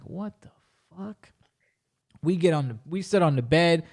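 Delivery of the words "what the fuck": very soft, higher-pitched disbelief; baffled under the breath; stunned hush. Retold or imitated. Man stunned by her mind-control talk, hushed. His stunned reaction retold.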